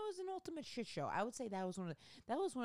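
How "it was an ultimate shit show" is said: high, strained exasperation; exasperated squeal. Real, not acted. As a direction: squeak out the disaster. Exasperated recap.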